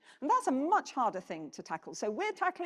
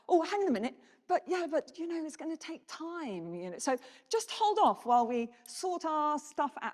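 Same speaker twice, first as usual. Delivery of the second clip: higher, mocking placating voice; hammed-up reassuring stall, raised pitch; mock stalling excuse; 6 semitones higher than usual. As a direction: mimic a complacent official putting things off. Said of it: "It's like".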